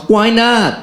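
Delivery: high mock yell back. Woman yelling back in the poem's argument, high yell. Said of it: "She yells".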